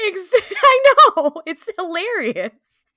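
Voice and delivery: loud, high delighted outburst; laughing agreement. Real, not acted. Host agreeing with cohost about their show, real laughing outburst. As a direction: burst out laughing, drawing the words out.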